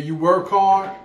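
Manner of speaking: usual speaking voice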